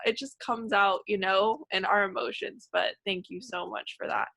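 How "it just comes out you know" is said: normal talking voice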